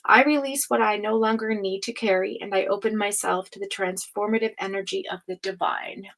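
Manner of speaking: affirmation fading into a whisper. Real, not acted. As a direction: read it like a gentle vow, then whisper. The affirmation goes as this.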